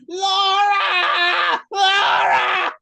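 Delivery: loud, high, drawn-out cry of a name; full-out imitation of an agonized yell. Clark yelling during his reaction in the goo, yelling. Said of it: "He's yelling".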